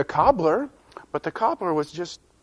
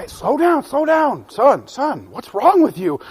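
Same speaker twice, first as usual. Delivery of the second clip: mild parental scold; 8 semitones higher, 5 dB louder than usual. Acted. Parent scolds rushing son.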